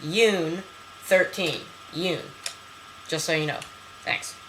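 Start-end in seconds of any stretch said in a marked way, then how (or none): 0.0-1.6 "Yoon" said like "moon" with a y, slowly; "Yoon thirteen", slow and deliberate; careful pronunciation. Shown as a demonstration. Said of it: "So it's pronounced". 1.9-2.2 "Yoon" repeated alone, rhymes with moon; "Yoon", short and falling; careful pronunciation. Shown as a demonstration. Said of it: "So it's pronounced".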